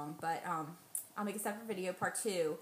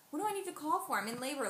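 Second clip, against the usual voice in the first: higher, irritated pushback at the husband; exasperated retort; 6 semitones higher, faster than usual. Retold or imitated. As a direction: snap back, why bother calling. Arguing back at husband.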